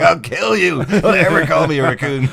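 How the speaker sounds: mock furious Rocket. Podcaster imitates Rocket attacking Kevin Bacon, furious shout. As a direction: lunge at him with the words.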